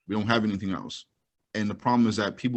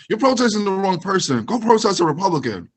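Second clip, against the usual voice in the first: higher, louder lecturing voice; mock lecturing supporter; 8 semitones higher, 5 dB louder than usual. Co-host mocking someone who lectured protesters, higher. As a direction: lecture like a know-it-all. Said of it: "line I got from someone".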